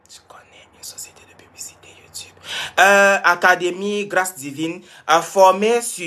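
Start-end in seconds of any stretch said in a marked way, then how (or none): 0.1-2.3 very soft, quick whisper; reading under breath. Real, not acted. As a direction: murmur it quickly to yourself. Reads a comment to herself. (lecture chuchotée à voix très basse)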